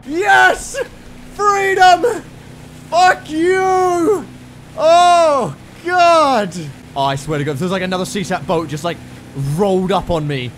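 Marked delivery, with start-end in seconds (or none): 0.1-6.5 high, loud bursts of shouting; exultant yelling. Real, not acted. Player breaking free and cursing the enemy, exultant shouting. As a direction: yell it out at full voice. Escapes in game, calls out.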